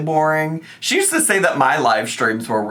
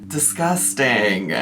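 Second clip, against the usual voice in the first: normal talking voice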